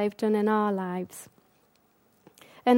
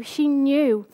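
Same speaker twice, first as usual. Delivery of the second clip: ordinary talk, nothing marked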